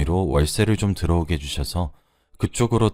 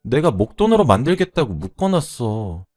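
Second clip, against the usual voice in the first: pleading excuse; 5 semitones higher, 4 dB louder than usual. Acted. Tearful borrower asks lender for time, pleading excuse. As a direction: beg to be believed.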